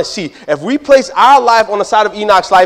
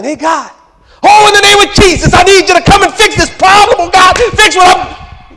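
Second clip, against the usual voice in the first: hammed-up desperate shout, very high and loud; yelling prayer; 14 semitones higher, 9 dB louder than usual. Believer far from God begging for a fix, yelling. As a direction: cry out to heaven at full volume. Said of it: "We are over here yelling".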